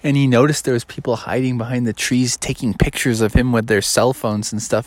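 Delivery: usual speaking voice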